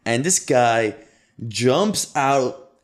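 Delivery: ordinary delivery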